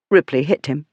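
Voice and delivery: usual speaking voice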